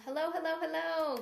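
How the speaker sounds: high, bright sing-song hello; excited greeting. Real, not acted. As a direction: greet them with a big lift. Greeting live viewers joining.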